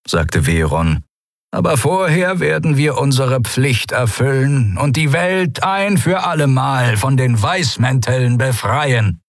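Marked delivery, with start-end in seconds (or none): 1.5-9.2 hohe, feierliche Amtsstimme; in gehobener Stimmlage eine Pflicht verkünden; entschlossener Minister. A narrator's reading. Veron erklärt seine Pflicht. (Minister, hoch und entschlossen)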